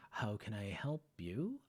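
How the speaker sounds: soft slow whisper. Acted. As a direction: hush it gently. Teacher soft reply.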